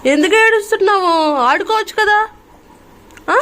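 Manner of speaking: loud, high-pitched coaxing. Real, not acted. Mother asking crying baby to play, high baby talk.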